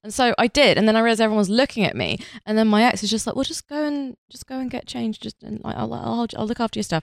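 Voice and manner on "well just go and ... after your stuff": softly voiced, slightly raised offhand quote; soft, light retelling of someone else's words; casual quoted ex. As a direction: imitate a partner's quiet, casual suggestion. Acts out ex in anecdote.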